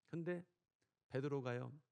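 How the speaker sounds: ordinary talk, nothing marked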